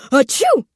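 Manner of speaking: high loud sneeze burst; big sneeze. Acted. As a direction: sneeze it out hard. Sneezing fit.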